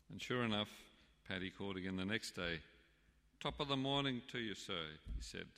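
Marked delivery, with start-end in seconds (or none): 3.4-5.0 Irish greeting. Retold or imitated. Paddy's cheery Irish greeting.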